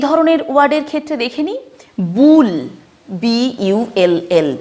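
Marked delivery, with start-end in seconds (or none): 2.2-2.5 "বুল" with a clear, held oo. Shown as a demonstration. Teacher showing learners the U sound, "বুল" with oo. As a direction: say "বুল" loudly with a long oo vowel. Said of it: "সাউন্ডে উচ্চারণ".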